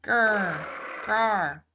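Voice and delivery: loud, obviously fake tiger growl; a kid's growly tiger noise, pushed loud; really fake tiger roar. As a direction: ham up a tiger roar that fools nobody. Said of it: "That sounds like a really, really fake tiger roar".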